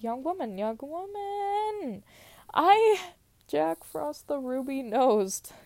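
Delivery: high, slow sing-song sprite voice; mock Jack Frost. Jack Frost crying out to the girl, high and drawn-out. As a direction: stretch it out high like a prancing elf. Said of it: "He cried".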